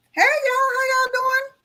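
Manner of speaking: high-pitched hello to a group; cheery greeting. Retold or imitated. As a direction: sing out a high greeting to everyone. Retold greeting to group of women.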